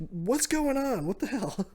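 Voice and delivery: high-pitched startled protest; mock bewilderment. Luffy tackled by Momo, high mock-bewildered cry.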